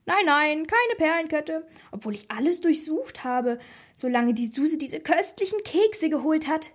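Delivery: put-on high-pitched, giddy old lady; excitable grandma voice, swooping high; animated grandma. A narrator's reading. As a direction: gush about it like a giddy grandma. Tipsy grandma voiced in story.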